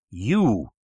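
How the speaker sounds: loud, startled exclamation. A narrator's reading. Doctor facing an old acquaintance, startled exclamation. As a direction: burst out in shocked recognition. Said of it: "in a startled whisper".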